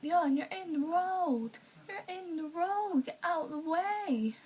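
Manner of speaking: put-on insistent child ordering someone off the road; bossy little cousin. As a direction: drag out each word like a stubborn kid.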